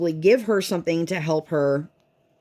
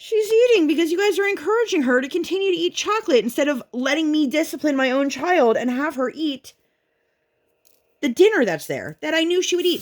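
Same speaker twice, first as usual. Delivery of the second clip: high, rapid-fire indignant rant; frustrated mom in full protest; exasperated retort; 11 semitones higher than usual. Retold or imitated. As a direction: retell telling off the relatives, pitched way up. Retells snapping back at relatives.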